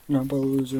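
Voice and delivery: loud, angry jab; dad yelling. Retold or imitated. Dad shouting at his son against the wall, loud. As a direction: imitate dad yelling at him.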